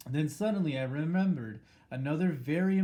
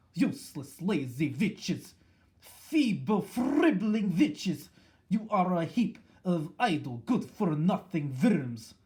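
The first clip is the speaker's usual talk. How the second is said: slow, higher, louder witch's scolding; furious yell; 4 semitones higher, 5 dB louder, slower than usual. A narrator's reading. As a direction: spit the insults at the lazy witches. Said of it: "she yelled".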